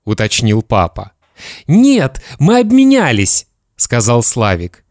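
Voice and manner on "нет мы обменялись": put-on high-pitched eager child; boy's reply. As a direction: answer dad as a little boy. Boy correcting his father.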